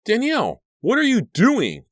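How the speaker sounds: very high, loud shrill cry. A narrator's reading. Cliff breaks into Danielle's thoughts, shrill. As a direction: shriek it, piercingly high. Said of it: "Cliff's shrill voice broke through".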